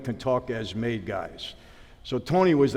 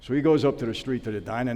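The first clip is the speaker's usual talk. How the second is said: ordinary delivery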